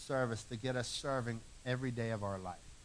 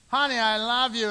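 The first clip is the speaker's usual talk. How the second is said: higher, louder "Honey, I love you"; mock loving declaration; 8 semitones higher, 9 dB louder than usual. Husband declaring love to his wife, higher and louder. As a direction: proclaim it brightly, like you mean it.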